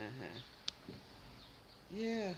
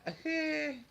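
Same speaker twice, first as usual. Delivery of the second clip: high-pitched, loud burst of laughter; 6 dB louder than usual. Real, not acted. Reacting to the live chat, high squealing laugh.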